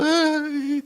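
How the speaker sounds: loud, high hammed-up wail; mock crying. People weeping crocodile tears over their victim, mock crying. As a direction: burst into a fake sob. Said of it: "están llorando".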